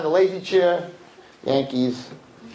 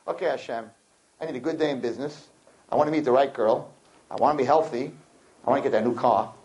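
low, soft list of requests; perfunctory prayer; 7 semitones lower, 3 dB quieter than usual. Acted. Worshipper handing God a shopping list, perfunctory and low. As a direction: reel off a wish list in an undertone.

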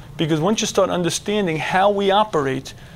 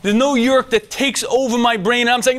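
ordinary talk, nothing marked

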